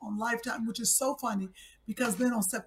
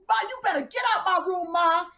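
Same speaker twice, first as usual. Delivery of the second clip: playing bratty daughter; 5 semitones higher than usual. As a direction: yell at mom like a spoiled teen. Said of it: "I'm Hannah".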